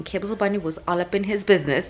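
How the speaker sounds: normal talking voice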